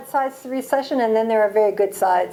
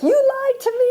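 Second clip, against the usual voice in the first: squeaky high mock accusation, half laughing; mock outrage; 14 semitones higher than usual. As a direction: play up the betrayal, way up high. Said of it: "I was like".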